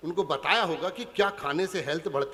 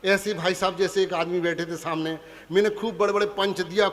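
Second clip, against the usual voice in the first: ordinary talk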